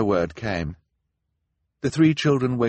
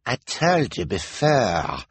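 slow, higher put-on voice; smug detective; 5 semitones higher, slower than usual. A narrator's reading. Detective corrects the children before the crowd, smug drawl. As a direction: draw it out condescendingly.

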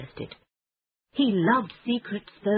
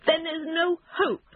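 high-pitched despairing wail; hopeless, high put-on voice; miserable cry; 11 semitones higher than usual. A narrator's reading. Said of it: "cried Toad miserably".

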